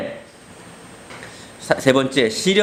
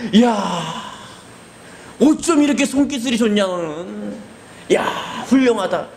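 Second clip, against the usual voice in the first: high exaggerated praise; hammed-up impressed father; mock admiration; 7 semitones higher than usual. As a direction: marvel sarcastically at the kid. Father mockingly praises skill.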